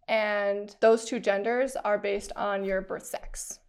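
ordinary talk, nothing marked

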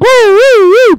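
high loud siren wail; siren imitation.

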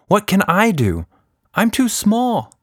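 high, small and anxious; a scared little girl pleading; worried girl voice. A narrator's reading. Tilda worried, narrated story.

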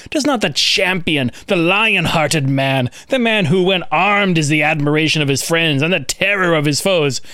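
put-on lightly theatrical reading of an old text; dramatic reading. Host reads angry ancient critic to co-hosts, mock outraged. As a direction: read it like an indignant Roman moralist. Reads a Roman quote aloud.

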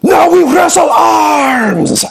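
high, slow roaring command; booming shout. Acted. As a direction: bellow the challenge. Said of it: "And he says".

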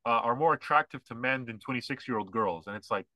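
ordinary delivery

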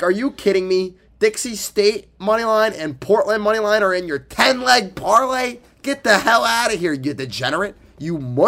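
mock outraged scolding; incredulous ribbing. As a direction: tease him like he's lost his mind. Scolding friend over ten-legger.

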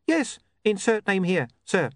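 high, chirpy little imp; bright eager gadget voice. Acted. Imp answers.

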